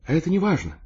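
high, eager woman's protest; heated objection. A narrator's reading. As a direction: brush the obstacle aside ardently. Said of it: "горячо возразила она". (горячо возражая)

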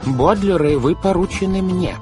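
high, forceful guardian's decree; firm insistence. A narrator's reading. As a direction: assert authority over the children. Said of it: "твёрдо сказал мистер По".

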